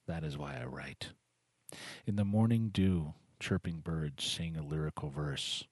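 soft, measured poem recital; hushed refrains, lines falling softly away; poetry reading. Acted. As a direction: read it gently, letting each line settle. Reads a submitted poem.

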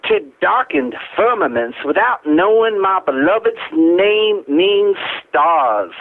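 slow, drawn-out Southern oratory; Southern accent. Acted. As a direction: recite it like a Southern orator savoring bourbon. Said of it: "the Southern accent, my own Southern accent".

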